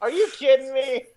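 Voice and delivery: high-pitched incredulous outcry; real astonished exclamation; disbelief. Real, not acted. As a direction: cry out in amazement at the roll. Reacts to the natural twenty.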